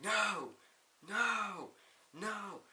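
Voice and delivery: high, repeated protesting cries; mock wrestler outburst. Daniel Bryan loses to C M Punk, mock protesting cries. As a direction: wail each one as a separate imitated protest.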